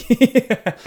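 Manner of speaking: usual speaking voice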